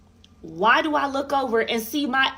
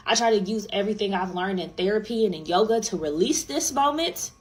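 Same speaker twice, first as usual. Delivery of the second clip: normal talking voice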